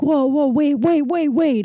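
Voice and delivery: high-pitched string of urgent protests; alarmed pleading. Retold or imitated. Imitating father stopping her walking out, alarmed pleading. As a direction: backpedal urgently, mock-panicked.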